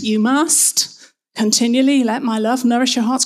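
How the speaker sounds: mock scolding command, firm and brisk; finger-wagging. Preacher showing how the verse once sounded, finger-wagging demand. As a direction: lay it down like a telling-off. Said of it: "a bit of a finger wagging moment".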